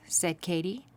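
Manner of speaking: plain reading voice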